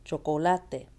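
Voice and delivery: Spanish "Chocolate" as cho-co-LA-te; "Chocolate" in Spanish, third syllable stressed. Shown as a demonstration. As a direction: lean on "la" in "Chocolate".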